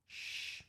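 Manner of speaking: soft, breath-only shh; whispered shush. Acted. Iris hushing the worried lion, whispered shush. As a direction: hush someone quietly. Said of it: "whispered Iris".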